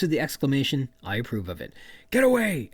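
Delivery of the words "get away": high, loud mock yell; exclaimed shout. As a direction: yell it as if to a stegosaurus.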